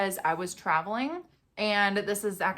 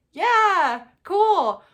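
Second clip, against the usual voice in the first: high, loud mock nonchalance; casual shrug; 11 semitones higher, 9 dB louder than usual. Family feigning calm, mock casual. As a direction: play it cool on purpose. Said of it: "we were like".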